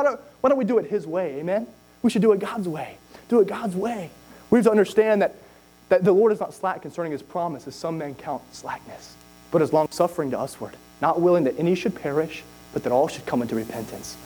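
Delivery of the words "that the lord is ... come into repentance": hushed low recitation of scripture; scripture reading. Acted. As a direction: drop the voice and read the verse.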